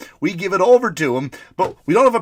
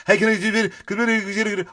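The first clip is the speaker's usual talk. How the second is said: higher-pitched gabbling mock neighbour; hurried neighbour; 5 semitones higher than usual. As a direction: imitate someone blurting something in a hurry. Neighbour rushing over during commercial.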